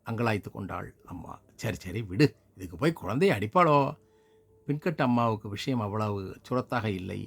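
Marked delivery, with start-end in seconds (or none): 1.5-3.8 quick, louder brush-off; lightly voiced neighbour saying let it go; dismissive neighbour. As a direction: neighbour waving the mother down. Neighbour soothing, waving it off.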